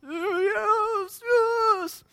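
high, wailing mock sob; put-on tearful squeal; imitated crying child. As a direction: whimper it like a tearful little boy. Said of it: "sometimes he's crying".

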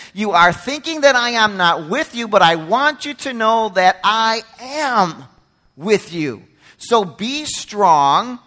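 loud, slow, emphatic charge; preaching God's promise. Acted. God tells the returned exiles he is with them, emphatic preaching. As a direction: speak as God reassuring his remnant.

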